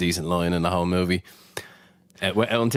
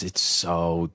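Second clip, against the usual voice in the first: bored drawl; 4 semitones lower, much slower than usual. Real, not acted. Bored complaint about the movie.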